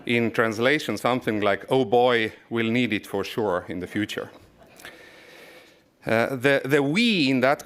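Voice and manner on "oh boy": ordinary talk, nothing marked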